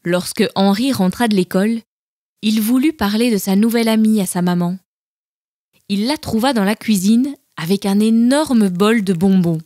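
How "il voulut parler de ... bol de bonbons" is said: plain reading voice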